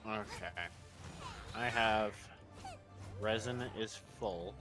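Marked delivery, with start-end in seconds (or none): none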